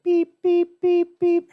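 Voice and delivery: high, loud mimicked monitor beeps; imitated beeping. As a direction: beep like an oxygen alarm, high and sharp. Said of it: "it's like".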